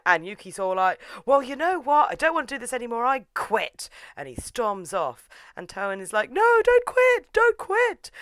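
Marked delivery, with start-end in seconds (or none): 1.2-3.9 higher-pitched mock indignation; acted huffy outburst. Acted quitting in a huff. 6.3-8.1 high, loud, drawn-out wail; imitated panicky begging, much higher and louder; mock desperate plea. Acted desperate plea to stay.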